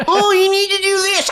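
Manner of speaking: shrill mocking singsong; mocking high voice. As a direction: mimic her nagging, way up high. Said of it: "mocking Charlotte's advice".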